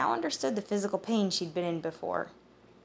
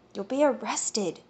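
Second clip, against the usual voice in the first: hissed; 4 semitones higher than usual. Acted. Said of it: "Esta hissed".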